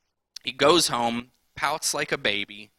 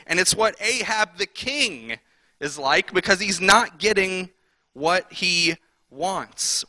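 ordinary talk, nothing marked

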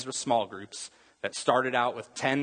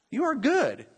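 stronger joyful praise; 13 semitones higher than usual. Retold or imitated. Repeats praise to God.

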